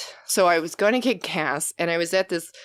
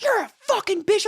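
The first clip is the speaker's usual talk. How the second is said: high, loud mock yell of the man; mocking shout; 3 dB louder than usual. Man at the gas station yelling at her, high mock shout. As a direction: fling the insult back high and loud. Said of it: "he's like".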